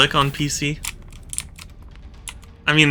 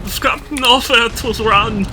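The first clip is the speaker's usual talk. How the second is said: high, hammed-up lament; a higher mock-tragic cry; mock despair; 7 semitones higher than usual. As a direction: play it as doomed theatre. Melodramatic despair continues.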